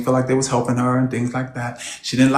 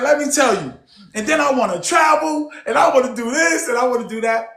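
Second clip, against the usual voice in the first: eager chatter; 8 semitones higher than usual. Retold or imitated. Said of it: "She said".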